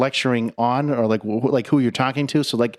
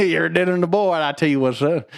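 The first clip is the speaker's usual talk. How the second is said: rooster impression; 4 semitones higher than usual. Foghorn impression banter.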